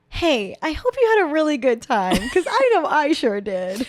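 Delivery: high, loud, perky sound-bite imitation; exaggerated cheery shout, slowing at the end; Lizzie McGuire. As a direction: belt it out like a teen star hyping a crowd. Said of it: "sound bit that's like".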